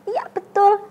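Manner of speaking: usual speaking voice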